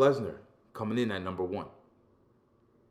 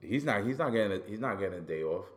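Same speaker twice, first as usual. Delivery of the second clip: usual speaking voice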